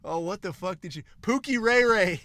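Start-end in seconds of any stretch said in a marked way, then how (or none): 1.2-2.2 excited recall. Real, not acted.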